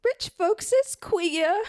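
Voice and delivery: slow, high comic housewife; high-voiced housewife. Mrs. Rogers remarking on rich folks, high comic voice. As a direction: draw it out, pitched well up.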